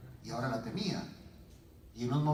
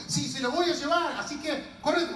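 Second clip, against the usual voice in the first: high, determined reply; acted insistence; 9 semitones higher than usual. Woman taking perfume to Jesus, acted insistence. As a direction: push past whoever blocks you. Acts woman pushing past sibling.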